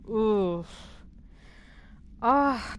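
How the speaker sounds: normal talking voice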